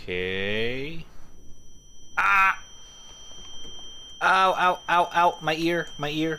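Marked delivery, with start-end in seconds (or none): 2.2-5.4 sharp loud yelps of pain; real startled cries of pain. Real, not acted. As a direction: yelp as if your ear got hit. Ear hurt in horror game.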